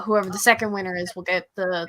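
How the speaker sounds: ordinary talk, nothing marked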